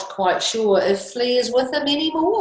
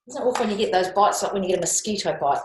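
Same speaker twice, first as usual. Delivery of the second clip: plain reading voice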